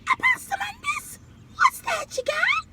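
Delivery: very high, tiny armadillo squeak; piping high critter voice. Acted. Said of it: "And the armadillo said".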